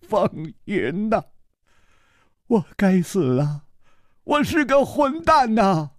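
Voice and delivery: a touch of sobbing grief; a servant lamenting his dead love, mildly tearful; weeping. Acted. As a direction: cry out the self-blame, ending in a whisper. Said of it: "他痛哭着说".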